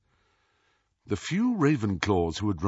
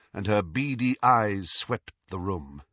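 ordinary delivery

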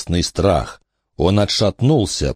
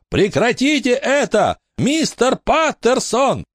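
desperate shout. A narrator's reading. Billy begging the ghost Mr. Patterson to stop. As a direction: cry out to make it stop. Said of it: "снова закричал Билли".

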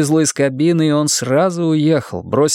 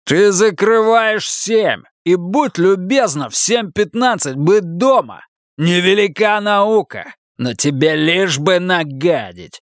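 raised pitch, slow emphatic rebuke; angry scolding; 7 semitones higher than usual. A narrator's reading. Husband telling wife when to be home, scolding. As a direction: spell it out with contempt.

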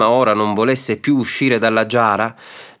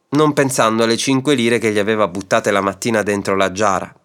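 ordinary delivery, nothing marked

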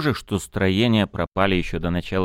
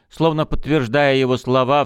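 ordinary delivery

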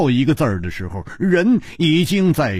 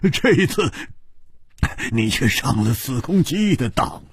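laughing heartily. Acted. Hu Tiehua tells his friend he was tricked, laughing. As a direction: burst of laughter running into the words. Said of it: "胡铁花大笑道".

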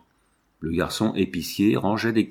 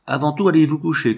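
higher, louder, quick order; commanding; 6 semitones higher, 5 dB louder, faster than usual. A narrator's reading. Master ordering the frightened maid to bed, loud command. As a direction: snap the order out fast. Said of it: "commanda-t-il".

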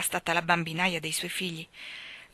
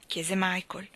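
plain reading voice

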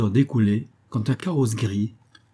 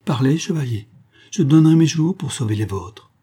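higher and louder, urgent plea; impassioned plea; 5 semitones higher, 5 dB louder than usual. A narrator's reading. The lady offers her life for the knight, urgently. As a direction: beg him to live. Lady pleads with suicidal lover.

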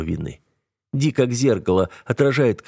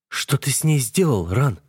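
high, frightened sister's question; trembling voice; 5 semitones higher than usual. A narrator's reading. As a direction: ask it with a quiver of fear. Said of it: "спрашивает дрогнувшим голосом".

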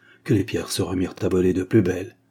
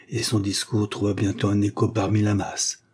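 ordinary delivery, nothing marked

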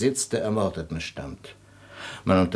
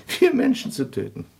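giggling repeat. Acted. Doctor mocking own theory.

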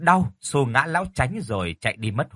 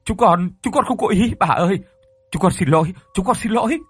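high, loud panicked pleading; stammering in fear; 7 semitones higher, 4 dB louder than usual. A narrator's reading. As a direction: beg forgiveness in terror, tripping over words. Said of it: "miệng thì lắp bắp".

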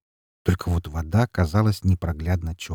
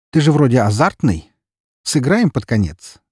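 лукавое предложение; 6 semitones higher, 6 dB louder than usual. Acted. Незнакомец предлагает игру.

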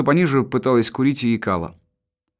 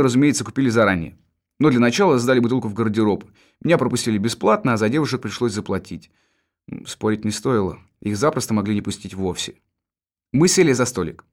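ordinary delivery

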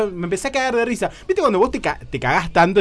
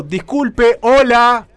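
loud, higher call over the shower; 7 semitones higher, 9 dB louder, much slower than usual. Retold or imitated. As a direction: call out louder over the noise. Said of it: "empecé a gritar básicamente". (llamando fuerte por encima de la ducha)

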